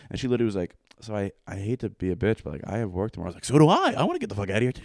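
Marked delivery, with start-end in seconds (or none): 3.4-4.8 much higher and louder burst of relief; eager agreement. Retold or imitated. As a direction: jump in, glad to escape.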